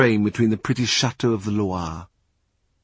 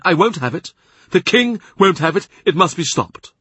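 higher, louder imperious churchman; angry Cardinal; 6 semitones higher, 4 dB louder than usual. A narrator's reading. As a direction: pounce on every excuse, rising in anger. Cardinal threatens Boleyn.

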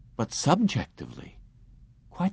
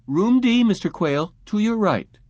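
higher, louder call across the room; calling after him; 11 semitones higher, 4 dB louder than usual. A narrator's reading. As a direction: call out directions as he walks off. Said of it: "she called after him".

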